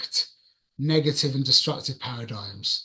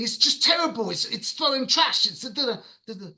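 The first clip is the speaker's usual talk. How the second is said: high, exasperated sputter trailing into nonsense; indignant ranting; 7 semitones higher than usual. Retold or imitated. Repeating his protest to himself, indignant. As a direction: re-enact a worked-up non-answer, pitched up. Mimics his own non-answer rant.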